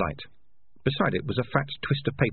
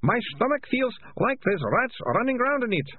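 high, wailing complaint; moaning. A narrator's reading. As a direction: whine in misery, pitched right up. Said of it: "moaned the Ratcatcher two".

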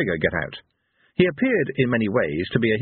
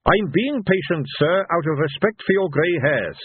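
higher, politely warning officer; Captain Carrot; 8 semitones higher than usual. A narrator's reading. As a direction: stay formal, pitched up. Carrot warns the old men.

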